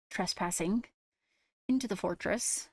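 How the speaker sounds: ordinary talk, nothing marked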